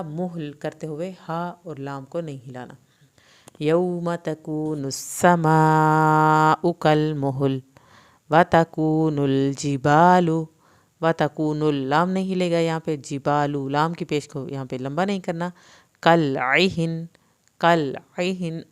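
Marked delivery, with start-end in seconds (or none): 3.6-10.3 slow, loud, drawn-out tajweed chant; Quran recitation. Shown as a demonstration. Teacher recites aloud for students, slow loud chant. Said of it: "teacher models the recitation". 16.0-18.6 کل عہن with the ain from the throat, slow. Shown as a demonstration. Tajweed teacher models the throat letter, slow. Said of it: "عین حلق سے پڑھیں گے".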